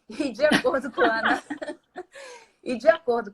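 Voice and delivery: talking through a laugh. Real, not acted. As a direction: laughs after the joke, then goes on. Joke about [name] lyric. (rindo no meio da frase)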